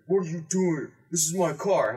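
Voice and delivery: a hint of an indignant car owner; retold angry driver.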